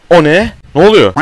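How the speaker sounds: high, loud frightened outburst; startled panic. Real, not acted. Player caught by the monster, loud startled cry. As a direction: jolt up in real fright.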